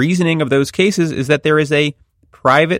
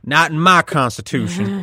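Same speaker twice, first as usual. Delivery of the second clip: mock indignant judge; 6 semitones higher than usual. Mock voicing of the court.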